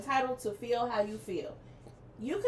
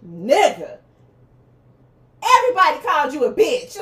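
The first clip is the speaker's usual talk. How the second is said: loud roasting; 3 semitones higher, 9 dB louder than usual. Real, not acted. Roasting [name] on the slap.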